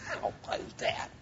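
breathy, voiceless mock outrage; hushed indignation. Preacher mimicking people bugged by applications, hushed indignation. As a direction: whisper it as a scandalized grumble. Voicing bothered church members.

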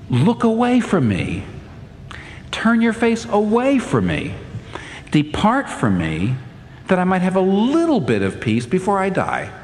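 desperate plea. Retold or imitated. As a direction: beg God to look away, worn out. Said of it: "He is saying".

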